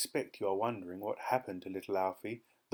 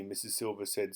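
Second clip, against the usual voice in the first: ordinary delivery, nothing marked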